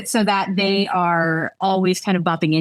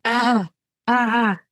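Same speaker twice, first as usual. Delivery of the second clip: throaty, wobbling; 4 semitones higher than usual. Acted. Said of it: "I said throatily, wobbling".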